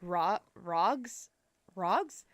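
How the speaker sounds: tentative ROGS with an open ah vowel; RAGS as rogs. Shown as a demonstration. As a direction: try ROGS for rags, questioning, with a long ah. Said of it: "more of an H sound".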